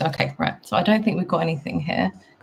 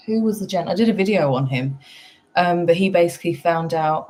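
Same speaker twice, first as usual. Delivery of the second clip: ordinary talk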